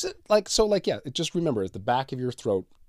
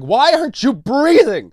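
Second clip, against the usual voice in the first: much higher, louder, slower alarmed cry; alarmed partner; 10 dB louder than usual. Pretend. Bed partner waking the sleeper, alarmed cry. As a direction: wake them in a fright, calling out. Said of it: "It's like".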